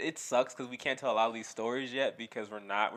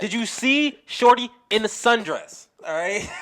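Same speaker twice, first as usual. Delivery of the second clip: loud, high, drawn-out question; worked-up comic rant; yelling; 9 semitones higher, 11 dB louder than usual. Real, not acted. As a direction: yell it slowly in hyped disbelief. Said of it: "Stop yelling at me".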